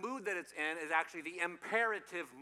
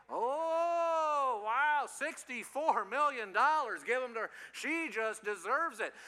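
high, loud, drawn-out amazement; mock-thrilled and booming; game-show excitement; 7 semitones higher, 6 dB louder, slower than usual. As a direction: play up awarding a huge jackpot. Mock prize award to audience.

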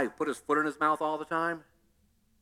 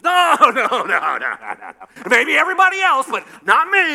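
protesting shout; 5 semitones higher, 8 dB louder than usual. Retold or imitated. Peter protesting loudly.

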